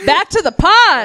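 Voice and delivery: high, drawn-out call back to the show; mock announcement. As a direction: call it out high and slow, cutting off the moaning. Cuts off co-host with show catchphrase.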